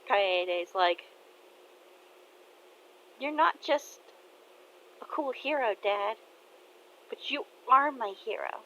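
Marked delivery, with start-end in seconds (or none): 3.2-8.6 put-on higher girl's voice, heartfelt; young daughter telling dad he's her hero; daughter's voice.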